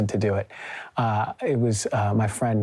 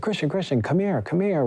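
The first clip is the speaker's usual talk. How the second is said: higher mock call across the driveway; retold friend calling his name; 6 semitones higher than usual. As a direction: hail him eagerly from a distance. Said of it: "She's like".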